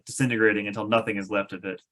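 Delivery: ordinary delivery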